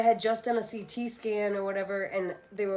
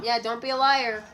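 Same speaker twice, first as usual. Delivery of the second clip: teasing call to daughter; 4 semitones higher, 7 dB louder than usual. Real, not acted. Mom teasing daughter about more.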